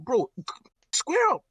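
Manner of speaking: cartoonish high-pitched fight call; mock tough guy. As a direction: ham up a drunk lad squaring up. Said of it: "you're like".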